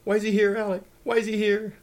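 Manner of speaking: high, loud panicked screaming; screaming old lady. A narrator's reading. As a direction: scream in alarm, as if Death came calling. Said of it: "screamed the old lady".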